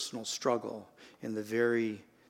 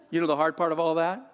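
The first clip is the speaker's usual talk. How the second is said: ordinary delivery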